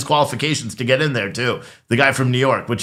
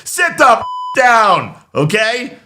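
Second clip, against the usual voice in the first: mock anger; 5 semitones higher, slower than usual. Pretend Speaker scolding a member.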